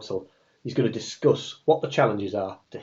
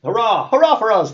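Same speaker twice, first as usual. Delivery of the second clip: high hearty cheer of nobles; cheering shout; 10 semitones higher, 7 dB louder than usual. Acted. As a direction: cheer it out loud. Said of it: "they all shout".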